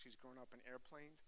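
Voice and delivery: normal talking voice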